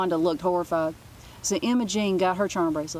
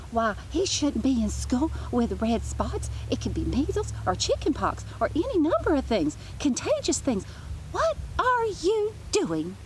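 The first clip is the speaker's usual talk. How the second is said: fussy nurse; 8 semitones higher than usual. A narrator's reading. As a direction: fret like a prim nurse spotting germs. Nurse voice in story.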